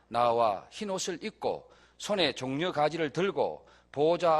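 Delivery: slow, measured scripture reading aloud with the congregation. Acted. Pastor leading the congregation in reading Revelation, slow and measured. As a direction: read each phrase slowly and evenly.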